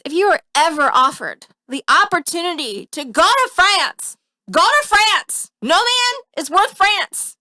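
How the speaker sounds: high, loud emphatic plea. Real, not acted. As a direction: urge them, all worked up. Mock advice to listeners.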